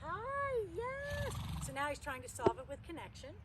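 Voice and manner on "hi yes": high, bright squeal of hello to an animal; pet greeting. Real, not acted. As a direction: greet a pet with delight, pitched way up.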